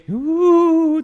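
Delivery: high ghostly wail. Acted. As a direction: moan it like a ghost. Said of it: "I gave".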